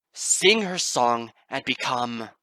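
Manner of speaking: very loud, slow and steady; booming command. Acted. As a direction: proclaim it before she falters. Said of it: "she lurched".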